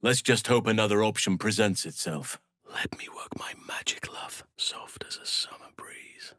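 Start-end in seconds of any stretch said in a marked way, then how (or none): 2.7-6.3 soft intimate whisper; tender hushed whisper. Acted. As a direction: breathe it close to her ear. Stealth line in game.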